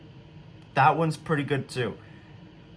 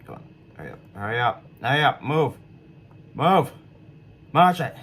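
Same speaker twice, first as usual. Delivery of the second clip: pleading with a machine; 5 dB louder than usual. Real, not acted. Urging the game to move.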